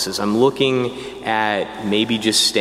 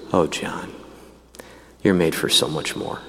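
gentle mentor; 7 semitones lower, 5 dB quieter than usual. Retold or imitated. Mentor priest gently telling John.

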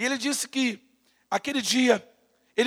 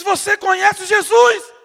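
loud, slow, high-pitched question; shouting the question at the injured man; insistent shout; 9 semitones higher, 11 dB louder, slower than usual. Retold or imitated. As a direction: plead it loudly over the chaos. Retold street evangelism, shouted.

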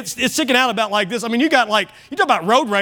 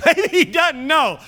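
slow, high, laughing repeat; 9 semitones higher, slower than usual. Pretend. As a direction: chuckle through Maybe he doesn't know. Joking about oblivious driver.

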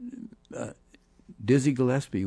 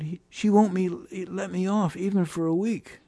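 high-pitched, put-on protest; upset complaint; 10 semitones higher than usual. Sidney tells Mez the boss refused, high-pitched complaint. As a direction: retell his grievance in a raised, strained pitch.

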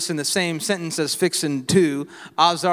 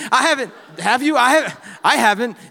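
laughing admission; 6 semitones higher, 5 dB louder than usual. Real, not acted. Joking with dad in congregation.

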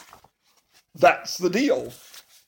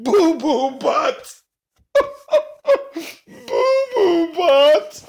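high squealing laugh, then slow, giggly "Boo Boo Butt"; giggling fit; 9 semitones higher, 3 dB louder, slower than usual. Pretend. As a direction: crack up and barely get the name out. Reader cracks up at the name.

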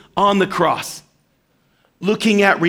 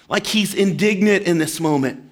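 ordinary delivery

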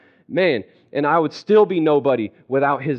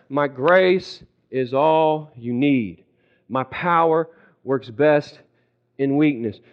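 very slow, lower, steady voice; calm divine reply; 4 semitones lower, slower than usual. Acted. God answering a desperate believer, calm and slow. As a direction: space the words out, unmoved and patient.

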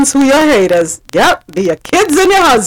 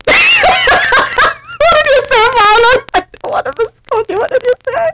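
laughing high voice; 13 semitones higher than usual. Retold or imitated. Mimicking Marlon's laughing reply.

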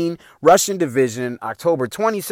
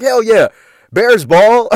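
triumphant cheer; 6 semitones higher, 6 dB louder, slower than usual. Retold or imitated. Celebrates grabbing the football.

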